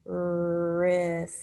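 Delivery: "Wrist" with long r and s, t detached; stretched sounds. Shown as a demonstration. Teacher helps pupils count sounds, stretched sounds. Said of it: "How many sounds are in that word?".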